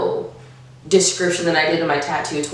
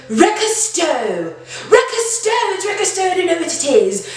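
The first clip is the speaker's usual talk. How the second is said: put-on British accent; 11 semitones higher than usual. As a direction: say it like a posh British girl. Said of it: "Mary has a British accent".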